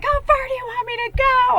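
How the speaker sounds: high, loud exasperated cry. Retold or imitated. Pissed off at Spirit, crying out high and loud. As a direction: plead in mock outrage. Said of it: "I'm, like, pissed off".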